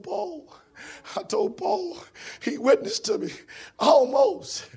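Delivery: high, acted wail of regret; mournful, high-pitched character voice; regretful lament. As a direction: lament a missed chance. Damned Agrippa laments missed chance.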